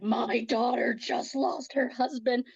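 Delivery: slow, ominous, protective mom; measured, commanding put-on voice, softly menacing; Darth Vader voice. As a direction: imitate a mother in menacing mom mode. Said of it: "It was like this Darth Vader voice".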